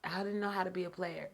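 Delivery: normal talking voice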